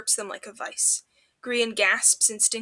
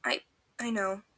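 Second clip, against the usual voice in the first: shaky breath. A narrator's reading. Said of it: "he breathes shakily".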